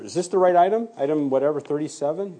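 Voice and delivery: urgent checking. Retold or imitated. Double-checks item while disputing the report.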